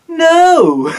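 high, loud, drawn-out cry; dramatic shout. Acted. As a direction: shout it as a comic plot twist. Mock dramatic twist to the story.

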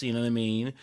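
ordinary talk, nothing marked

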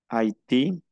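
HAITÍ as "a-i-TEE", silent H; French tréma demo. Shown as a demonstration. Teacher giving an example of sounded A I, French tréma demo. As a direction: sound A and I in HAITÍ, rising at the end. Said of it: "se pronuncia A".

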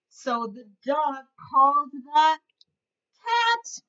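lively storybook voice for little ones; reading to children. A narrator's reading. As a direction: read it like a teacher at story time. Reads picture book to kids.

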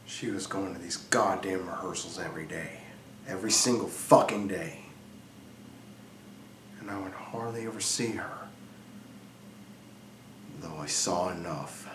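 hushed, low, brooding delivery with long pauses; brooding confession. Acted. Jealous husband confiding in Frankie about his wife, hushed and brooding. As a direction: confide it softly and low, letting pauses hang. Brooding opening of dramatic monologue.

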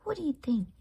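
ordinary delivery

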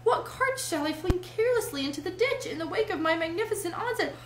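grandiose rapture. A narrator's reading. Toad dreams of flinging carts in ditches, grandiose rapture. Toad rhapsodizes over his motoring future.

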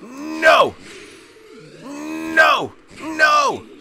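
excited taunting. Real, not acted. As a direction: yell at the enemy mid-fight. Fighting the boss, taunting it.

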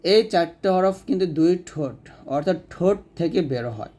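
ordinary delivery

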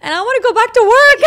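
tearful wail breaking into a laugh-cry; wailing through tears. Retold or imitated. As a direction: blurt it out between sobs and laughs. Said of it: "hysterically crying".

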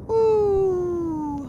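high excited whoop. Real, not acted. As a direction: whoop for the gifted subs. Cheering wife gifting subs.

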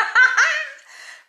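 loud, high-pitched real laugh; burst of laughter. Real, not acted. Woman reacting to a suggestion to FaceTime him, bursting out laughing. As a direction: crack up suddenly.

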